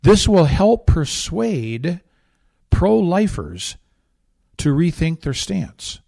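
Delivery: slow, drawn-out sneering read; slow mock reading of Chelsea Clinton's statement; mocking slow quote. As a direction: read her words slowly, dripping with scorn. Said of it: "this is her statement".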